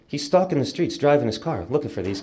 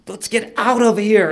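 high, louder retold plea; desperate plea; 8 semitones higher, 5 dB louder than usual. Son grasping his father one last time, desperate plea. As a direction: raise pitch and volume in a last appeal.

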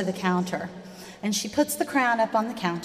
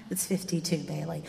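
put-on quiet, low answer to the child; softly feeding the child the answer; soft reply; 7 semitones lower, 10 dB quieter than usual. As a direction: murmur the answer back. Mom whispers answer back.

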